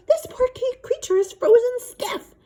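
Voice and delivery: high, slow, mock anguished wail; a hammed-up lament for the poor creature; outraged dad. Father outraged over frozen gecko.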